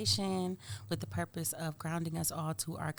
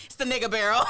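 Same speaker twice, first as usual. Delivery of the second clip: loud, high laughing line; 6 semitones higher, 11 dB louder, faster than usual. Real, not acted. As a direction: crack up while saying it. Laughing punchline.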